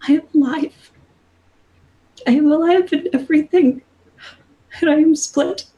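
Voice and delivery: high, tearful goddess voice; a lost god pleading; weeping goddess. Acted. Goddess answering the warrior Sedna, tearful and high.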